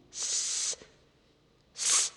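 soft drawn-out hiss; puzzled hiss. A narrator's reading. Gollum stumped by Bilbo's riddle, hissing. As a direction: hiss while thinking. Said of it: "said Gollum".

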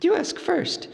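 buy time politely; hobbit voice. A narrator's reading. Bilbo letting Gollum ask first, hurried. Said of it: "he said".

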